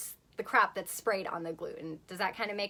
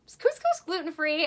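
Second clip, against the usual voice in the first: higher, worried question; anxious question; 7 semitones higher, 3 dB louder than usual. Retold or imitated. Asking her mentor at lunch, a high anxious question. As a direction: ask it high and nervous. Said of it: "I look over at her and I go".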